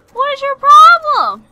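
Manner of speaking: high, very loud outburst; exasperated yell. Pretend. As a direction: holler at the enemy in exasperation. Yelling at enemy again.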